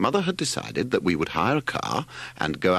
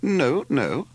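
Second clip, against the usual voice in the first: higher, lightly voiced mother; untruthful; 8 semitones higher, 4 dB louder than usual. A narrator's reading. Mother untruthfully reassuring the driver, mild high denial. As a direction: deny it in a mild, higher-pitched voice. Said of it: "said Mother untruthfully".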